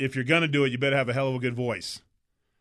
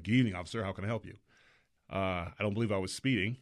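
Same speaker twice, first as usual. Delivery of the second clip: lower, softer, very proper; polite police voice; 6 semitones lower, 7 dB quieter, faster than usual. Retold or imitated. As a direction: charm the officer, extra polite. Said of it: "a great voice for talking to the police".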